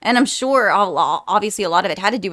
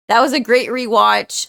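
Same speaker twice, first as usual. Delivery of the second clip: ordinary talk, nothing marked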